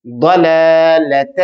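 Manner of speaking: ضلالت slow, daad heavy and full. Shown as a demonstration. Teacher models ضلالت slowly for the class. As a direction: drag out ضلالت with a thick daad. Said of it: "دعات کو موٹا پڑھتے ہیں".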